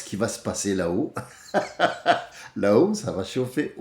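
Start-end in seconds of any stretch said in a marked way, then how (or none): 1.5-2.5 short burst of laughter. Real, not acted. Speaker amused at what will happen up there, laughing. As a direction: laugh in a quick breathy run.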